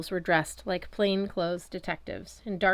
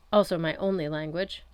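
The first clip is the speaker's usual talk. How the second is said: plain reading voice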